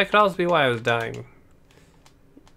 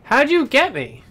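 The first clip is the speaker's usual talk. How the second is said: high, loud startled cry; shocked outburst; 10 semitones higher, 7 dB louder than usual. Real, not acted. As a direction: yelp it out in shock. Sudden shocked outburst in game.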